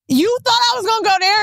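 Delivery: mock-outraged at the idea; incredulous. Telling a friend off about a Jersey party, incredulous. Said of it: "And I was like".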